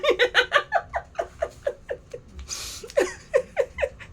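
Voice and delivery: high, long burst of real laughter; giggling hard after the teasing remark. Real, not acted. Laughs at her own joke.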